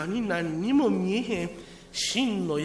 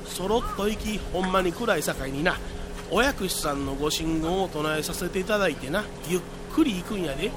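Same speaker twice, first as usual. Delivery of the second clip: ordinary delivery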